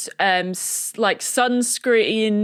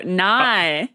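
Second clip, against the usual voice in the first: Australian no; 2 semitones lower than usual. Shown as a demonstration. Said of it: "Just the word no".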